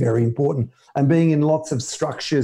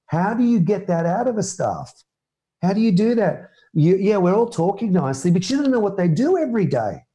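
normal talking voice

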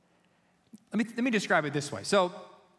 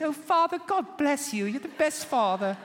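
put-on high, childlike British accent, sugary and proper; kids' British accent; 8 semitones higher than usual. Imagined sons thanking dad in British accent. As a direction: play the kids gushing in a posh British accent. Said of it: "my children were now gonna have British accents".

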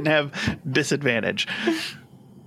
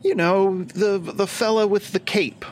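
Lich voice; 8 semitones higher than usual. Acted. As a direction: insist they remember him. Lich describing the caped fella.

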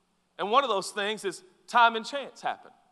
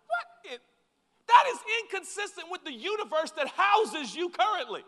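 usual speaking voice

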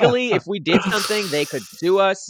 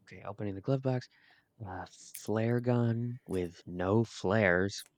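hushed; 7 dB quieter than usual. Acted. Searching quietly near sleeping child.

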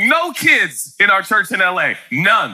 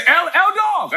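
calling out; 13 semitones higher, slower than usual. Retold or imitated. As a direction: yell across the house. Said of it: "he would always say".

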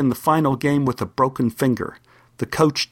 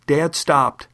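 ordinary delivery

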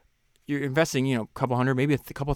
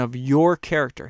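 your leaned on and drawn out; stress on your; 6 dB louder, slower than usual. Shown as a demonstration. Host echoing the author's emphasis, stress on your. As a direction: hit the word your. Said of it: "He emphasized the word your".

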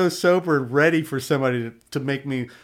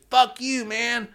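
mock drunk snarl; 8 semitones higher, much slower than usual. Voicing the drunk's insult.

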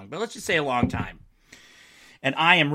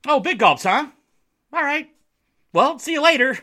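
goofy movie quote, pitched up and punchy; Dumb and Dumber; 9 semitones higher, 5 dB louder than usual. Acted. Host quoting a film to fans, goofy high impression. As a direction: imitate a cheerful dimwit, high with little pauses, laughing at the end. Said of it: "going for that Dumb and Dumber vibe".

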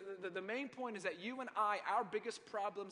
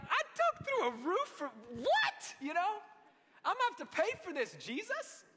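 much higher, louder, hammed-up outrage; indignant friends; 11 semitones higher, 7 dB louder than usual. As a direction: whine like friends who wrecked a roof. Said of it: "The friends were like".

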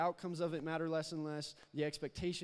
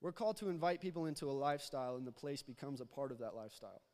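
louder, pressing preaching voice; building sermon; 2 semitones higher, 5 dB louder than usual. Acted. Preacher urging the church to go out, louder preaching. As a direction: drive the point home to the congregation.

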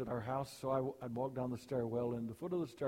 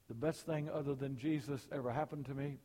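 ordinary talk

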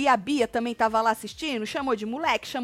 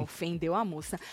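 normal talking voice

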